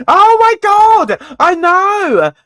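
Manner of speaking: high, loud, drawn-out exclamation; emphatic agreement. Real, not acted. Agreeing with viewers about people talking through headphones, emphatic. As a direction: cry out in heartfelt agreement.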